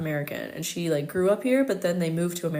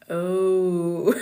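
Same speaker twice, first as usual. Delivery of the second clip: drawn-out, louder dismayed "oh", breaking into laughter; dismayed realization; 6 dB louder than usual. Retold or imitated. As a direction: let out a long mock-dismayed oh. Reacts to own French recording.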